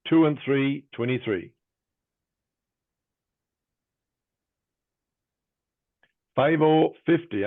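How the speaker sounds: sing-song bingo number calling; bingo caller. Real, not acted. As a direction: lift the first half, drop the second.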